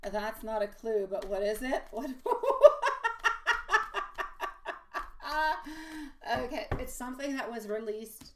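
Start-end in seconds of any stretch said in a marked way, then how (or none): 2.1-6.3 long high peal of laughter; real amused laughter. Real, not acted. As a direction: let the laugh run on, high. Laughing at a viewer's question.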